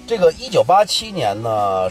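usual speaking voice

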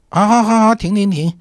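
high, exasperated okay-okay; giving in. Retold or imitated. Driver gives in to the director wanting zongzi, high exasperated giving in. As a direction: give in to a stubborn child, lightly mock.

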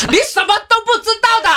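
mock exasperated outburst, very high and fast. Friend losing patience while giving directions, mock angry outburst. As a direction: imitate a mild friend finally snapping, pitch way up. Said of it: "那么脾气好的海员都对我发火".

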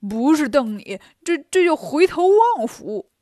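clownish Bajie. Acted. Bajie to staring-match victim Fortune Star, clownishly proclaiming. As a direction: draw out the punchline in a put-on voice.

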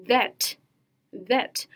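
"that" begun with a V, like "vet"; clear V sound. Shown as a demonstration. Teacher contrasts two word sets, "that" with V onset. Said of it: "注意发这个音的时候".

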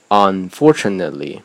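"unfortunately" with a clear stop after "un", then stressed "for"; pause before stress. Shown as a demonstration. Teacher giving a practice word, "unfortunately" split by a pause. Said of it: "可以多拿几个单词练练".

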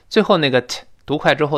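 ordinary talk, nothing marked

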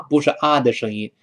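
usual speaking voice

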